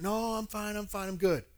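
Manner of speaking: quick, lightly acted reassurance; brushing off concern. Churchgoer assuring his pastor he is fine, dismissive. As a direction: wave off the worry as a churchgoer would.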